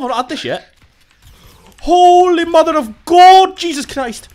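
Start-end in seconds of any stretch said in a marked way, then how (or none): 1.8-4.3 slow, high, loud Welsh exclamation; Welsh accent. Pretend. Gamer shouting at a close call, in a Welsh accent. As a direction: stretch it out in a big Welsh voice. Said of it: "I don't know why I went Welsh for that".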